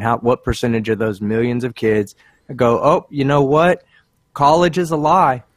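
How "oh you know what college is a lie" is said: mocking gullible kid. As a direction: parrot a naive kid who just got convinced. Kids parroting the video's claim.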